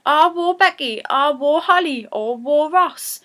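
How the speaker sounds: high, sing-song broad Geordie "war"; broad Geordie calling her family by name. Shown as a demonstration. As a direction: call out fondly in broad Geordie. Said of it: "if you're being really Geordie".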